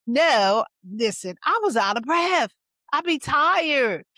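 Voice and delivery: playful self-defence to teasing listeners; mock-exasperated protest. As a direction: protest emphatically, dragging out the last words. Defending herself to laughing members.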